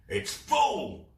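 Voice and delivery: high, loud thrilled cry; excited cry. Acted. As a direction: cry out in triumph. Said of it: "cried Captain Claw".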